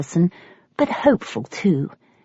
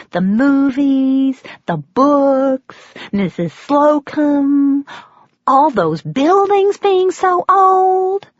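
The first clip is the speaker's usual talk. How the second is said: much higher, slow and louder, lingering on each item; waitress listing everything she loves about England; dreamy gushing waitress; 7 semitones higher, 4 dB louder, much slower than usual. Acted. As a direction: savour every word like a starstruck fan. Waitress listing English delights.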